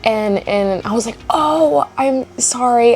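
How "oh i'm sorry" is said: mock apology. Apologizes to barista in retold exchange.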